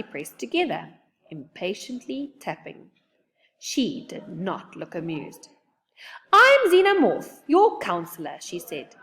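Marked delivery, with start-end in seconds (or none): 6.3-8.4 crisp, loud stern alien counselor. A narrator's reading. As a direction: announce yourself with authority. Alien counselor introduces herself.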